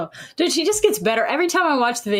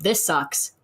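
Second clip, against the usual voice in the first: ordinary talk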